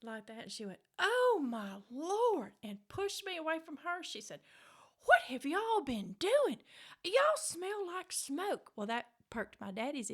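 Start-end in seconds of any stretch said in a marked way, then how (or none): 1.0-2.5 high, very slow shocked exclamation; mama's shocked gasp. Retold or imitated. Mother smelling smoke in her daughter's hair, slow and shocked. 5.0-8.7 high, slow, loud accusation; suspicious mama. Retold or imitated. Mother confronting her kids about smelling of smoke, high and slow.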